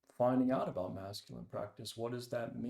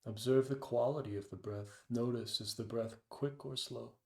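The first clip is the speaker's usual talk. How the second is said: guided meditation. Acted. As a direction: ease them into the breath, unhurried. Leads a meditation.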